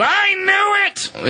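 high, slow gleeful shout; mock shout. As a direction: drag it out triumphantly. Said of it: "someone always looks down and goes".

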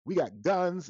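high, loud, shouting threat at him; upset shout. Retold or imitated. Angry neighbor shouting in his face about guns. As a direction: yell it right in his face. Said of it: "one woman that was really upset".

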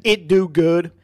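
loud, high goofy mocking tone; mock dumb voice. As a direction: parrot the bad grammar like a dope. Mocking partner's bad grammar.

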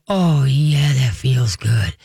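low, slow imitation of blissful relief; contented dog. Host playing a dog getting chest tickles, low and blissful. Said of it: "dogs you could just see go".

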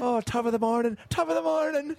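high-pitched cartoon Irish greeting; mock Irish accent. Teasing his friend about visiting Ireland, mock Irish accent. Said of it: "stereotypical stuff".

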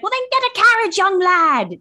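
high, loud put-on Victorian gent; hammed-up Scrooge; posh old gentleman. Cohost voices Scrooge in a high, loud posh voice. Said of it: "And he's like".

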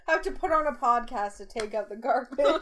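loud, overblown tweet reading; mock dramatic quote. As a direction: read it like a melodramatic complaint.

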